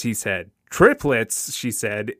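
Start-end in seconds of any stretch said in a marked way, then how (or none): 0.7-1.5 higher, louder exclamation of surprise; astonished echo, pitched up; exclaiming. A narrator's reading. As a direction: exclaim at startling news. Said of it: "She was exclaiming over".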